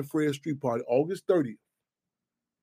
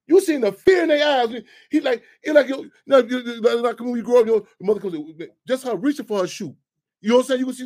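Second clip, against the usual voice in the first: excited; 6 semitones higher, 6 dB louder than usual. Real, not acted. Excited retelling.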